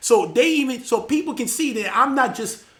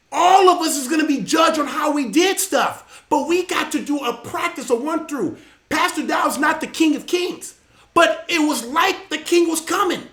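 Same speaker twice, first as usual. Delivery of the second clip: raised, higher-pitched preacher's delivery; impassioned preaching; 5 semitones higher, 4 dB louder than usual. Real, not acted. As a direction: drive each point home to the congregation. Preaching at full force.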